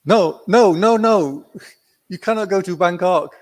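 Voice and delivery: high, loud protesting no no no; translator's loud, high protest; alarmed no. Retold or imitated. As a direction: stop him in a rush of alarm. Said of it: "He said".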